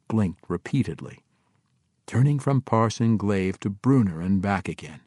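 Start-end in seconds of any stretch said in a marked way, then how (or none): none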